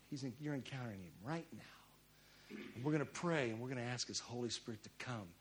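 soft, low invitation to prayer; hushed altar call. Real, not acted. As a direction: gently usher the congregation into prayer. Softly leads into prayer time.

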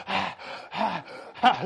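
heavy, exaggerated gasps; acted winded gasping; out-of-breath panting. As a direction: pant as if just done sprinting. Winded after running down hall.

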